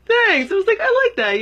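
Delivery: very high, loud, pleased reply; squeal-pitched thanks for a compliment; flattered delight. Retold or imitated. Woman told she's like Miss Europe, flattered and delighted.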